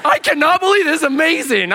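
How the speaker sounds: high, loud burst of disbelief; loud amazement. Retold or imitated. Companion watching a waitress accept prayer, exploding in amazement. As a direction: explode in hammed-up astonishment.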